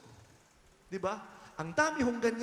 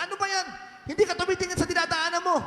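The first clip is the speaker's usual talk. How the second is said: mock irritated snap, higher and fast; angry snap; 7 semitones higher, 4 dB louder, much faster than usual. Churchgoer bumped leaving mass, snapping at the stranger, fast and irritated. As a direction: play the churchgoer barking at a stranger. Churchgoer snaps after bumping someone.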